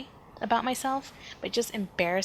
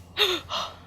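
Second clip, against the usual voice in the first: high shocked intake of breath; sharp gasp; 11 semitones higher than usual. Retold or imitated. Shocked woman at the checkout, sharp gasp. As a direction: gasp as if scandalized. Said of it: "And she gasped".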